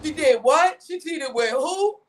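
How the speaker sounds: gossiping homie. Retold or imitated. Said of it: "I call you like you my homie".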